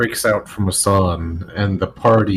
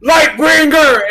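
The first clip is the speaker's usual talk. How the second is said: high loud chant of Lightbringer; crowd shouting; 7 dB louder than usual. Acted. Crowd chanting the name, high loud shouts.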